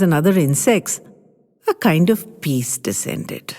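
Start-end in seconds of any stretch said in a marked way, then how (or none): 1.7-3.5 suspenseful narration. A narrator's reading. Slows for suspense.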